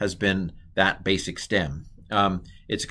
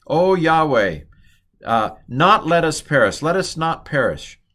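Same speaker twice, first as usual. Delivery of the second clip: loud, raised acted plea; sailors praying; 5 semitones higher, 5 dB louder than usual. Sailors begging God to spare them, acted prayer. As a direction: call out to God in mock desperation. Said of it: "they're praying".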